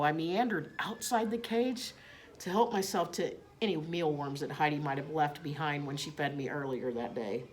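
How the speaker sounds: ordinary delivery, nothing marked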